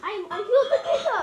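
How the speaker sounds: excited, loud and fast with a laugh. Real, not acted. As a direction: blurt it out laughing. Excited kill announcement.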